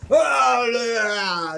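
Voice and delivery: mock furious shout, high and loud; furious outburst. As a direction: shriek like an enraged conductor losing it. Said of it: "He flies off the fucking handle".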